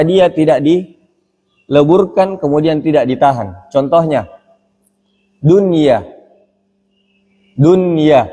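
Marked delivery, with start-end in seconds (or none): 5.4-8.3 "dunia" with the n sounded clearly, unmerged; clear nun. Shown as a demonstration. Teacher showing students the example word, clear nun. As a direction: say "dunia" keeping a distinct n before ya. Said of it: "Tidak dibaca duya".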